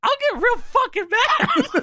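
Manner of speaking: high, drawn-out mock threat; Jerry Lawler. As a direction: squeal a comic tantrum, slowly. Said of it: "Lawler complains about his monitor".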